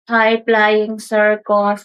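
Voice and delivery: low, slow, dull hello; lifeless greeting. Shown as a demonstration. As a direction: greet them slowly with no life. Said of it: "It's like good life".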